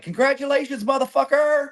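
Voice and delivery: high-pitched imitation of a loud buddy; loud friend's congratulations. Friend phoning with draw news, imitated loud and high. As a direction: holler it high, imitating an exuberant caller. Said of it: "he's just loud".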